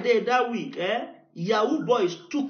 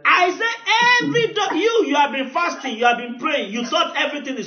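raised, pressing exhortation; fervent preaching; 3 semitones higher than usual. Acted. Preacher challenging churchgoers who trust only fasting, fervently raised. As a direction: drive the point home to the crowd.

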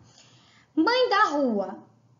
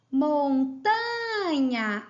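"Montanha" slow, TA stretched long; stress on TA; much slower than usual. Shown as a demonstration. Teacher showing the tonic syllable, TA drawn out in "Montanha". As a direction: hold the TA in "Montanha". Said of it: "é o tã".

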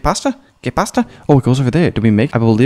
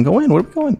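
normal talking voice

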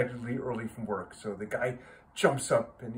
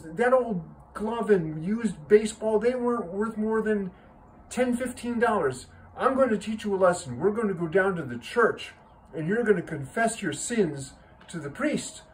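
high-pitched lecture from an outraged dad; indignant scolding; 7 semitones higher, 4 dB louder than usual. Acted. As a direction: lay down the law, voice raised. Father scolds son over sale.

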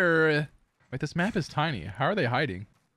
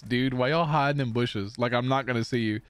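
ordinary talk, nothing marked

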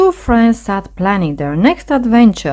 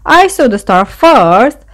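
mildly cross, higher and louder; 6 semitones higher, 5 dB louder than usual. A narrator's reading. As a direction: insist like a quarrelling little mouse. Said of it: "Little Brown Mouse replied crossly".

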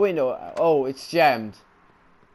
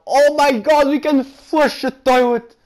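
excited; 7 semitones higher, 4 dB louder than usual. Real, not acted. Marvelling at game toilet.

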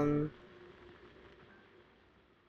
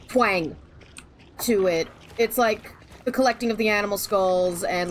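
normal talking voice

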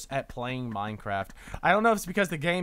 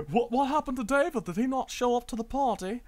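higher-pitched mock Cockney voice; Cockney accent; 8 semitones higher than usual. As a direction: put on a playful Cockney accent. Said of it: "it's a Cockney accent".

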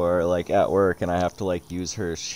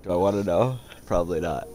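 usual speaking voice

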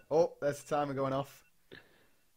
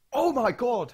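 much higher and louder, real disbelief; shocked cry; 10 semitones higher, 8 dB louder, slower than usual. Real, not acted. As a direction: cry out at the unbelievable save. Shocked at a save.

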